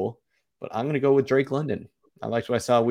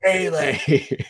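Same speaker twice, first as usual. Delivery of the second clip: Roy Kent echo; 5 semitones higher than usual. Retold or imitated. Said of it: "Best of Roy Kent".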